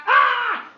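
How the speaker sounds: high, loud screech; cat pounce yell. Acted. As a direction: screech mid-sentence as it leaps.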